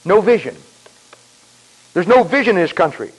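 ordinary delivery

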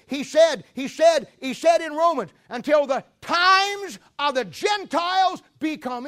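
loud, high, slow scripture call; preaching shout. Real, not acted. Preacher proclaiming Romans to the congregation, shouting. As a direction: proclaim it at full force.

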